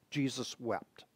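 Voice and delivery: near-whispered repeat. Shown as a demonstration. Preacher echoing the verse for his congregation, near whisper. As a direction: repeat it barely above a breath.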